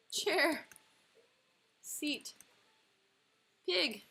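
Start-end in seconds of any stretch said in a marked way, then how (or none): none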